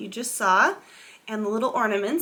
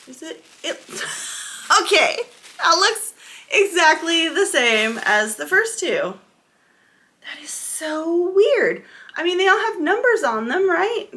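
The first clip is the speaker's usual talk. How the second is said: surprised; 5 semitones higher, 6 dB louder than usual. Real, not acted. Yarn color matches again.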